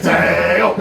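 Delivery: very loud, high mimicked holler; imitated taunt. Player retells teammate's taunt to friends, loud laughing mock holler. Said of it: "and he goes".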